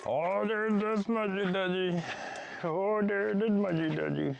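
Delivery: high slow pet voice. Real, not acted. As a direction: coo at the pet. Baby talk to pet.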